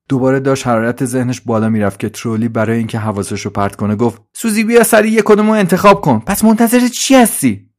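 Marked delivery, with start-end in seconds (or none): 4.3-7.7 high, loud, impatient helper voice; Trolley, urging. Acted. Trolley distracts Suzi, urging a choice, high and impatient. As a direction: hurry them along in a high, loud voice.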